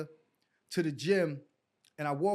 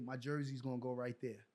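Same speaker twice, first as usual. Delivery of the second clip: softly promising himself, lower and hushed; quiet vow to himself; 6 semitones lower, 10 dB quieter than usual. Retold or imitated. Player recalling his vow under his breath, quiet. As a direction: murmur it like a private promise.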